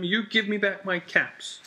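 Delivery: high, insistent storybook demand; scolding. A narrator's reading. Peddler demanding his caps from monkeys, high scolding. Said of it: "shaking a finger at them".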